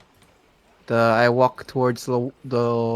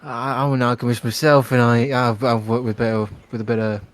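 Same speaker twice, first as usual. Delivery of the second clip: ordinary talk, nothing marked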